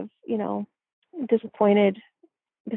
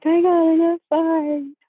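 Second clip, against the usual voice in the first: tearful; 8 semitones higher, 6 dB louder, slower than usual. Retold or imitated. Telling mom after crying.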